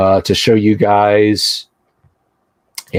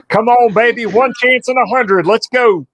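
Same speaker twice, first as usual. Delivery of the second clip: much higher, pleading with the dice; high gambler's plea to the dice; excited gambler plea; 13 semitones higher than usual. Acted. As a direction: beg the dice for luck. Rolling dice for a mutation.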